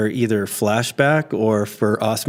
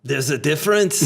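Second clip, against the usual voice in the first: put-on Austrian-accented action-hero warning; thick Arnold-style accent; Terminator. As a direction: play an Austrian movie cyborg. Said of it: "to the Terminator".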